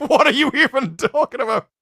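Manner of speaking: loud, high, laughing disbelief; incredulous outburst. Retold or imitated. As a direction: blurt it out, totally baffled. Baffled reaction to absurd clip.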